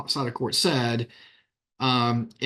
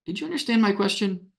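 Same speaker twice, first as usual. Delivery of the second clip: patronizing; 6 semitones higher than usual. Shown as a demonstration. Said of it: "I'll be a little patronizing".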